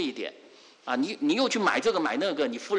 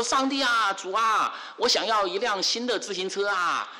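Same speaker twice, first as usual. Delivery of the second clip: put-on calling out high to God like a small boy; boy raising his voice so grandma hears; mock loud prayer of a little boy; loud child's prayer; 7 semitones higher than usual. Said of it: "他突然就把声音变得很大声".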